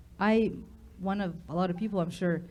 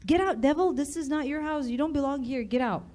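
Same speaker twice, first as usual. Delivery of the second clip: commanding; 5 semitones higher, 4 dB louder than usual. Retold or imitated. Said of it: "open the door and say".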